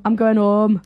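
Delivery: imitating Northern "going home" with the h dropped; dropped letters. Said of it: "how you just m-, drop off the letters".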